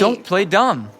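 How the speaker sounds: fed-up accusation; angry rebuke. Acted. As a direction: cut off the excuses angrily. Lovers' quarrel escalating.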